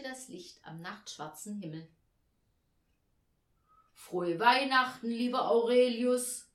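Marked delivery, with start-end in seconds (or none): 3.9-6.5 loud, higher welcome; hearty greeting call. A narrator's reading. Chief angel welcoming Aurelius back, loud greeting. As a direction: hail him at full voice. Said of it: "rief der Oberengel zur Begrüßung".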